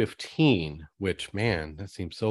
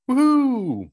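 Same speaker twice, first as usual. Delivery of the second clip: excited whoop; 7 dB louder than usual. Acted. Cheer ending the ad read.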